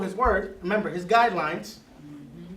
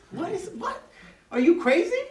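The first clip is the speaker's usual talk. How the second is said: incredulous; 11 semitones higher than usual. Retold or imitated. Mock reaction to gentle reply.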